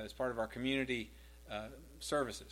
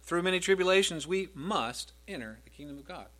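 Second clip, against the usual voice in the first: "Through many tribulations" said louder, the rest even; stressed verse reading; 4 semitones higher, 6 dB louder than usual. Shown as a demonstration. Preacher showing how to emphasize the verse, stressed reading. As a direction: press the verse forward with weight. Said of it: "And then you could emphasize".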